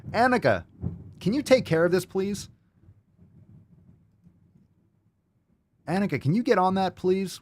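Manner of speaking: high, pleading summons; calling out. Pretend. Host begging Annika to step in, calling out high.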